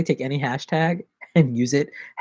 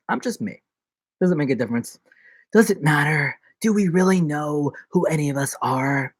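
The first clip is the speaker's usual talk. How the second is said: ordinary delivery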